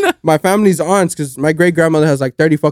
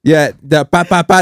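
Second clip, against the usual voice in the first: normal talking voice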